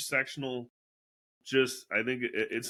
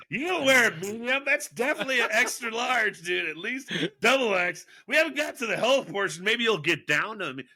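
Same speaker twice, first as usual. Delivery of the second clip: mocking Rudy's teasing; 8 semitones higher, 4 dB louder than usual.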